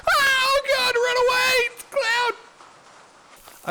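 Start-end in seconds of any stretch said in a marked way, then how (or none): 0.1-2.3 very high, loud squeaky cry; mock panicked passerby. Imagined stranger fleeing the clown, squeaky high cry. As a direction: shriek like a cartoon fleeing in panic. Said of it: "it's just".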